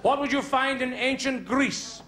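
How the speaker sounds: hammed-up classroom scene; teacher and pupils. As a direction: bark teacher lines, chirp the answers.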